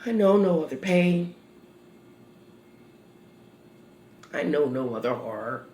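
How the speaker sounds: low, soft, sinking voice; hushed grief. Acted. Mother recalls the day her heart dropped, hushed grief. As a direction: let grief sink to a low hush.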